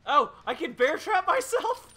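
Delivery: high, loud startled laugh; real surprised laugh. Real, not acted. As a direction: burst out laughing at his own mistake. Surprised at trapping himself.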